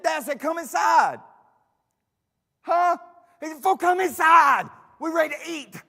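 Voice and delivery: distant shouting match over a field; shouting across a field. Pretend. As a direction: yell to someone far away. Servants shouting to David far off.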